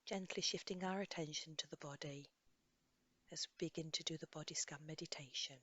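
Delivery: soft, unhurried guidance with long pauses; guided meditation. Acted. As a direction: gently lead a listener through a body scan. Leads a body scan meditation.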